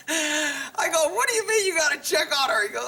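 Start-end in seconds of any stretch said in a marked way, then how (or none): 1.1-2.7 high-pitched, mock-indignant question; retold incredulous challenge. Off-duty cop to officer checking on girlfriend, incredulous challenge.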